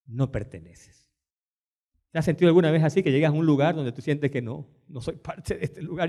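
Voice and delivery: normal talking voice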